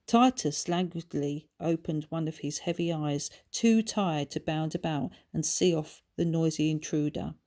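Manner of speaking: ordinary delivery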